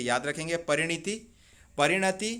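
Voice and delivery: normal talking voice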